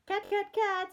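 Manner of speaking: high, loud call for a cat. A narrator's reading. Old man searching his house for a cat, calling out. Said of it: "he called".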